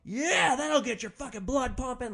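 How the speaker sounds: wild whooping high and loud; adrenaline-crazed shouting after a street fight; manic screaming. Retold or imitated. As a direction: whoop like a coked-up brawler. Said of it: "is screaming".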